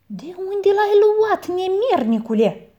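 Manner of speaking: high, loud, slow demand; aunt demands where the ox came from, high and loud; angry aunt. A narrator's reading. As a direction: interrogate the scoundrel. Aunt confronts boy.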